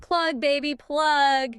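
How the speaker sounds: high, loud Texan tycoon shout; booming oil tycoon drawl. Retold or imitated. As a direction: belt it like a brash oil baron. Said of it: "tried to sound like a Texan oil tycoon".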